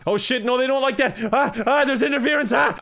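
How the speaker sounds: flustered panic. Real, not acted. Player fighting interference on the live broadcast, flustered panic. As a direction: yelp as it all goes wrong.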